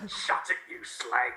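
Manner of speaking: put-on rough Cockney accent, lightly done. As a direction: snap it like a Cockney lad. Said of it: "call Cockney brother".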